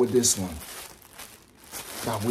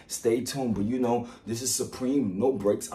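normal talking voice

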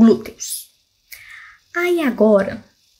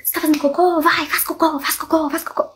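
pushy urging; 6 semitones higher than usual. Retold or imitated. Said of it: "alguém abre a porta do banheiro e fala".

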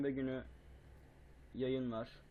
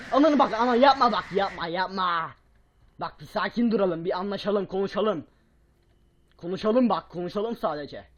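louder, higher begging to negotiate; loud pleading; 5 semitones higher, 13 dB louder than usual. Real, not acted. Begging the monster loudly to stop and talk.

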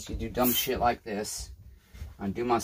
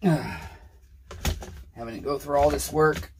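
weary sigh; 4 semitones higher, much slower than usual. Real, not acted. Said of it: "but this is frustrating".

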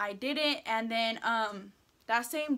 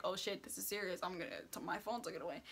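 hushed panicked thought; 5 semitones lower, 13 dB quieter, faster than usual. Retold or imitated. Panicked thought about losing phone.

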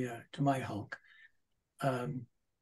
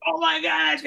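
amazed exclamation; 14 semitones higher, 8 dB louder than usual. Retold or imitated. Retelling amazed reaction backstage.

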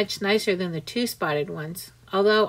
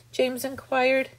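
ordinary delivery, nothing marked

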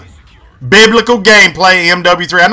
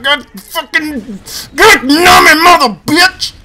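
slow, higher, half-crying curse; frustrated wail; 6 semitones higher, slower than usual. Real, not acted. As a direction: moan it out in despair.

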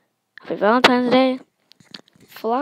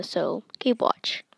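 ordinary delivery, nothing marked

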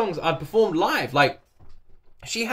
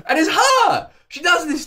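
overwhelmed cry; 12 semitones higher, 5 dB louder than usual. Real, not acted. Exclaiming about the singer.

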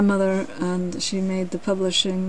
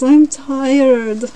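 higher, louder lion's complaint; mild roar; 5 semitones higher, 7 dB louder than usual. A narrator's reading. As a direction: roar it wearily, drawn out. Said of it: "roared the lion".

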